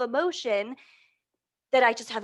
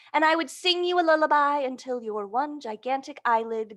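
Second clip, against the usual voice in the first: gentle picture-book read-aloud; storybook reading voice; reading to children. Acted. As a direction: read it like a bedtime story to little ones.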